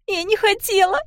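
put-on high, loud weeping child's voice; a child sobbing out that it was not on purpose; sobbing girl. A narrator's reading. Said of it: "продолжала рыдать Пиппа". (высокий громкий рыдающий детский голос)

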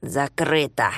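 low, angry muttering. Acted. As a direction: mutter it under breath in anger. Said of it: "пробормотал он со злостью".

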